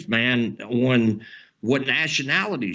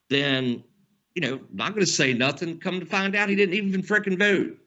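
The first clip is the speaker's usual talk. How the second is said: normal talking voice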